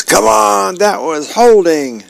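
high-pitched protest at the TV; indignant high-pitched holler; mock yell.

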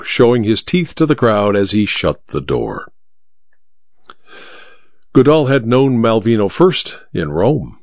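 ordinary delivery, nothing marked

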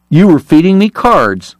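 high, astonished accusation. A narrator's reading. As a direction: realise it with rising disbelief. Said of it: "Eli stopped astonished".

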